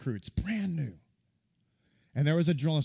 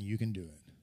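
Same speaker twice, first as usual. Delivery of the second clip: soft encouragement; 5 semitones lower, 6 dB quieter than usual. Retold or imitated. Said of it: "nobody cheering you on saying".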